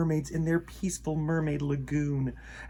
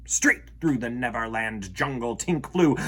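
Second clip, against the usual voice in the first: usual speaking voice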